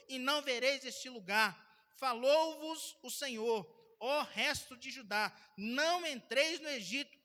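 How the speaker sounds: booming, preached Bible reading, slowing at the end; preacher thundering the Lord's words to the church; loud scripture reading. Acted. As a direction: proclaim the verses like a prophet's warning. Reads scripture aloud at full force.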